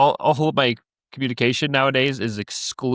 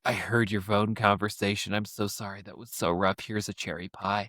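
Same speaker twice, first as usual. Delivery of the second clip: much softer, sympathetic hush; pitying neighbor; 4 semitones lower, 9 dB quieter than usual. Retold or imitated. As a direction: console gently like a neighbor. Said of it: "She's like".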